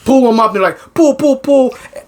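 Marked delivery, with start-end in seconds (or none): 0.9-1.7 high-pitched, put-on urgency; mock urgent calling. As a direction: imitate someone urging the haulers on, voice raised high. Retold men hauling someone up.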